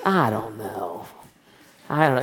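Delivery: slow, low, lingering mentor voice; a long, low, lightly voiced old mentor; drawn-out impression of Bob. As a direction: stretch it out the way Bob would. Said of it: "except he's short".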